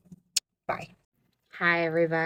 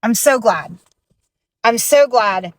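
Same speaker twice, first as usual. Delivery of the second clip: higher, louder emotional relief; 4 semitones higher, 5 dB louder than usual. Real, not acted. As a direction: voice lifted by real relief. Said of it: "starting to get a little bit emotional".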